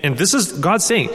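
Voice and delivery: ordinary delivery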